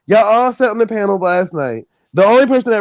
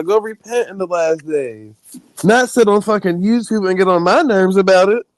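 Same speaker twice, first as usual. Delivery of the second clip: normal talking voice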